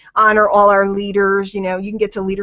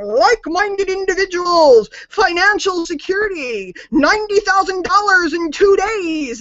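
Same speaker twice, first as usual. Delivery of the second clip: mocking MLM salesman; 7 semitones higher than usual. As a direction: ham up a pushy MLM pitch. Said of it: "running around going".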